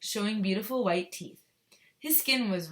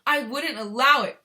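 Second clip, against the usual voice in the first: loud, raised protest; indignant cry; 6 semitones higher, 11 dB louder than usual. A narrator's reading. Wonka assuring the mother, crying out. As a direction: cry it out, higher and louder. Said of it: "cried Mister Wonka".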